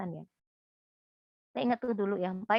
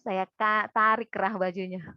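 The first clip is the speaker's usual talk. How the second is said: normal talking voice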